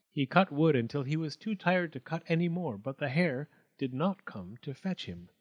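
plain reading voice

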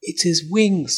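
ordinary delivery

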